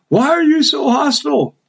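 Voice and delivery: Russian outlet complains of hostility, mock indignant; mock offended asker. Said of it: "so they wrote me again".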